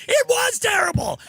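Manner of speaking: high shouted echo of it was terrible; shouted agreement. Retold or imitated. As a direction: yell it right back at him. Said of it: "And they went".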